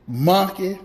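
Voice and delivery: normal talking voice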